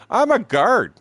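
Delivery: high, loud imitation of the guard; mimicking guard. Guard tells the friends he works at a prison, high mock voice.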